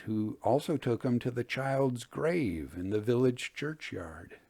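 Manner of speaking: usual speaking voice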